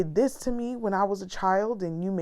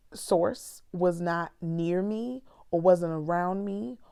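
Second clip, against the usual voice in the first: usual speaking voice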